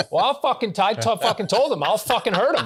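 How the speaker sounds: high, hammed-up furious rant; mock angry neighbor. Alone, mimicking the angry neighbor, hammed-up fury. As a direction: ham up the swearing rage. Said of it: "I let that character come out".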